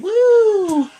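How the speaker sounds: high, loud imitation of a wrestler's woo; Charlotte's woo. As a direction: belt out a wrestler's woo call. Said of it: "She's like".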